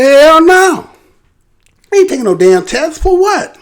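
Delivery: scoffing at the test demand; defiant refusal. Retold or imitated. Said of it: "She done said".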